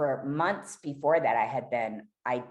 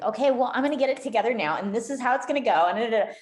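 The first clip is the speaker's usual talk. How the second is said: higher, louder, rattled off fast; mock confident planning; 9 semitones higher, 6 dB louder, much faster than usual. Imagined addict planning recovery, mock breezy confidence. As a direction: parody someone brightly reciting a neat plan. Mocking an overconfident addict's plan.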